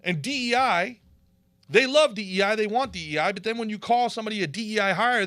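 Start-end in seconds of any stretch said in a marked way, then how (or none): none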